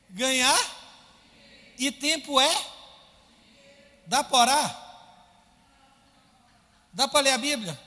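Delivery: loud, high preaching run; preacher hammering questions at the congregation; full-force preaching. Real, not acted. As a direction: drive every question home.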